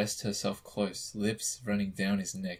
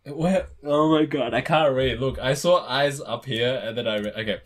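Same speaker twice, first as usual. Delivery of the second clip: flustered; 6 dB louder than usual. Real, not acted. Flustered, lost her place reading.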